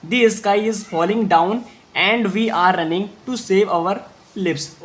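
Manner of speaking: slow, steady reread in one flow; slow repeat read. Shown as a demonstration. Teacher rereading slowly for students to follow. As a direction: say it again slowly for repetition. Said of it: "एक बार फिर से बोलेंगे".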